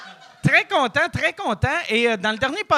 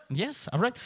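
normal talking voice

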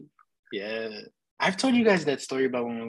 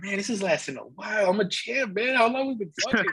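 higher-pitched retold stoned bragging; hammed-up wonder at your own stamina, pitched up; mock self-amazed boast; 9 semitones higher than usual. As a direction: play your impressed, clueless past self. Acts out his stoned self bragging.

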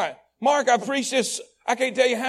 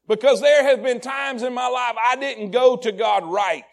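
fervent preaching; 4 dB louder than usual. Acted. Preacher rising confession.

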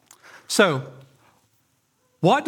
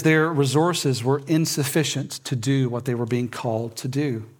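ordinary talk

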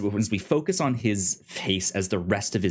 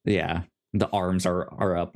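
ordinary talk

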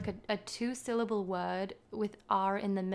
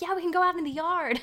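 higher, put-on American kid voice; American accent; 8 semitones higher, 4 dB louder than usual. Boy turning to his friend, put-on American accent. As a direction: switch into an eager American accent. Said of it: "he switches into".

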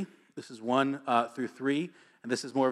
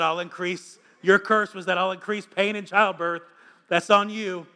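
teasing; 6 semitones higher, 5 dB louder than usual. Retold or imitated. Retelling teasing his wife in labor.

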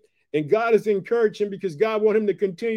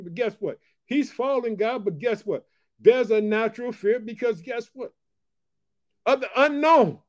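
ordinary talk, nothing marked

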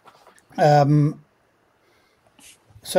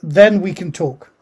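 ordinary talk

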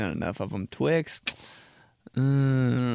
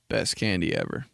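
ordinary talk

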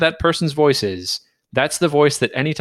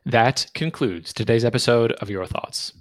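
ordinary talk, nothing marked

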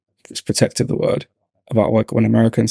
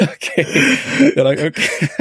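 laughing mock reaction; 7 semitones higher than usual. Mimicking reaction, breaking into laughter.

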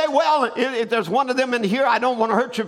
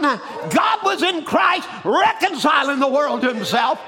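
shouted preaching, climbing higher; fervent preaching; 6 semitones higher than usual. Acted. Preacher roaring to the congregation about Lazarus, shouted. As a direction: build it to a full preacher's cry.

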